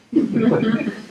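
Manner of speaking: high disbelieving laugh; short laugh at the absurdity; a real, high laugh; incredulous laugh. Real, not acted. Laugh after greeting story.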